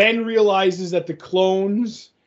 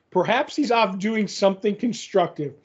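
normal talking voice

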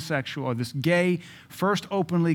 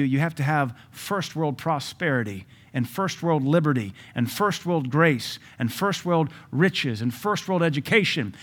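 ordinary talk

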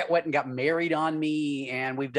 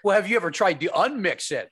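ordinary delivery

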